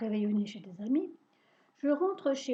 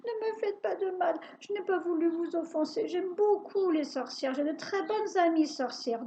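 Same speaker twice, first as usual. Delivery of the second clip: higher, begging voice; pleading; 5 semitones higher than usual. Acted. Boy on his knees begging the witch, higher. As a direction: beg for mercy. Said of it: "je me mets à supplier".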